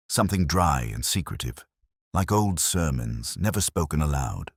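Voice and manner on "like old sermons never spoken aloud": ordinary delivery, nothing marked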